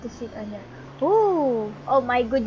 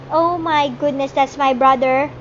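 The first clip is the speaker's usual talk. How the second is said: loud, amazed outburst; excited shout; 8 dB louder than usual. Real, not acted. Kid spotting his brother's Lamborghini, excited shout. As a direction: burst out with excitement, much louder.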